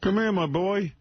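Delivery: high, hammed-up Jersey senator; mock senator. Host parodying the senator calling Jackie over, hammed-up high voice.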